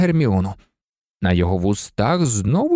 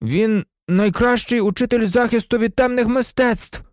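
high, heated protest from a pupil; passionate, high-pitched rebuttal; heated objection; 11 semitones higher than usual. A narrator's reading. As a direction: argue back hotly in a raised pitch. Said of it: "палко заперечив Дін Томас".

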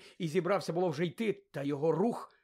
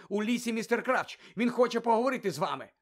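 urgent, higher-pitched report; hurrying to speak first; 6 semitones higher, 4 dB louder than usual. A narrator's reading. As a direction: get the news out before interruption. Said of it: "поспішив заговорити раніше". (квапливо, схвильовано)

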